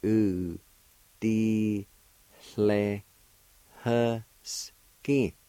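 U, D, hle, husky given one beat each, falling on husky; syllable by syllable. Shown as a demonstration. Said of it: "It's pronounced like this".

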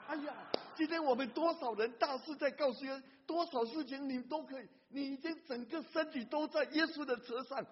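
loud, high fervent preaching; impassioned sermon voice; preaching at full force. Acted. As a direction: preach it out to the hall.

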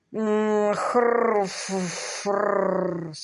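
drawn-out hum breaking into snorts; inarticulate grunting. A narrator's reading. Baronet sums up his dilemma in grunts and snorts. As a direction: hem and snort without words. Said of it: "обобщил эти чувства сэр Грегори".

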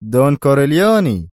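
mocking voice over intercom; mocking call. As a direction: sneer the name. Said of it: "صوت ساخر يقول".

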